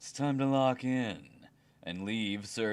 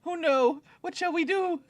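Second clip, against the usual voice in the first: mock panic; 4 dB louder than usual. Mock panic over a joke.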